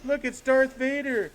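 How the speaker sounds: put-on high, slow kid voice pointing something out; imitating excited kid. A kid in the ad spotting Darth Vader, high and slow. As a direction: point and announce it like an amazed kid. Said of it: "and he goes".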